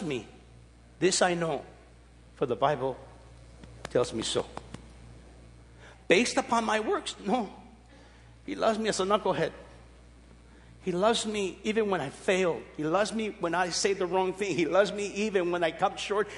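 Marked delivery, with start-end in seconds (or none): none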